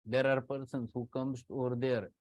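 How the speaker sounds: ordinary talk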